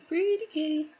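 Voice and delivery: put-on high cooing baby talk. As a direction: coo it to a little kitty. Cooing at stitched kitty.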